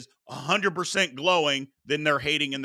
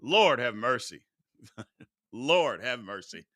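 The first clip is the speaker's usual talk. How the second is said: lower, softer, laughing "Lord have mercy"; exasperated; 6 semitones lower, 5 dB quieter than usual. Real, not acted. Host sighing at callers, low laughing exasperation. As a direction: let it fall out in weary disbelief. Exasperated sigh after rant.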